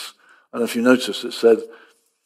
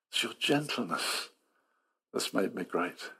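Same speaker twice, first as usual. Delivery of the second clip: hushed; 5 semitones lower, 14 dB quieter than usual. Acted. Repeating psalm quote softly.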